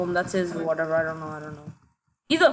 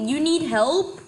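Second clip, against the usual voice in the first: mocking disbelief; 7 semitones higher, slower than usual. Real, not acted. Mocking teammate's plea.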